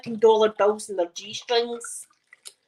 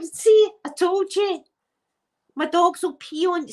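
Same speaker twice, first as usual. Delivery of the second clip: told-you-so; 8 semitones higher than usual. Retold or imitated. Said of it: "I was like".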